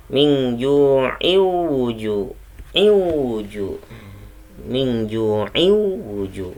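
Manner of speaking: very slow chanted min ju'i wuju; slow recitation, joined. Shown as a demonstration. Teacher reciting the joined verses slowly for students. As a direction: stretch it out, linking the verses. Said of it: "Kalau disambung".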